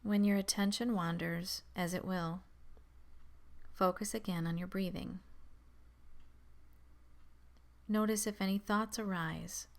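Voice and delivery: calm, measured meditation voice with long pauses; guided meditation. Acted. Guiding beginners to focus on the breath, calm meditation voice. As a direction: settle listeners gently, leaving space between instructions.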